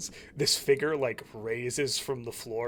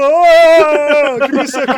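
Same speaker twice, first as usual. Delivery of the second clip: high, loud ghost voice; unearthly voice; 13 semitones higher, 18 dB louder than usual. Acted. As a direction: stall them in ghost voice. Ghost answers players.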